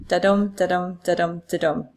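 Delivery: "da-dum, da-dum" with a weak da and a stressed dum; heartbeat rhythm. Shown as a demonstration. Teacher imitating the rhythm of the heart, "da-dum" in steady unstressed-stressed beats. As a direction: pulse "da-dum" four times evenly like a beating heart. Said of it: "That's the exact rhythmic pattern".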